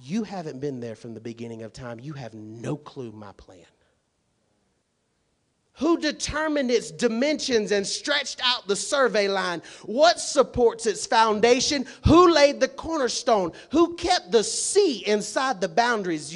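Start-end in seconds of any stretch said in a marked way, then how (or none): 5.8-16.3 high, loud rapid-fire questions; booming preaching. Acted. Pastor voicing God questioning Job, loud preaching. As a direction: fire each question from the pulpit.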